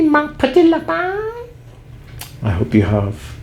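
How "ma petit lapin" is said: very high, playful squeak on the French; mock French endearment. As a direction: coo it in a silly high voice.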